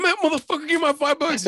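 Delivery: high, loud indignant demand; mock outrage. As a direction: demand your money back, half laughing. Retold demand for owed money.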